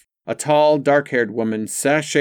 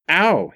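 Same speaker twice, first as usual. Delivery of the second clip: ow; 7 semitones higher, 4 dB louder than usual. A narrator's reading. Rob hit on arm.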